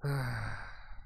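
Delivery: long, low, soft sigh. Acted. Vor before explaining his magical contract, long soft sigh. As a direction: exhale a drawn-out, quiet sigh. Game master sighs in play.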